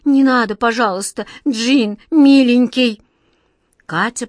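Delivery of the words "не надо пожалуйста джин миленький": put-on a girl's loud desperate plea; begging, louder. A narrator's reading. Katya begs the genie not to take her, pleading loudly. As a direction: beg the giant to spare her. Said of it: "взмолилась Катя".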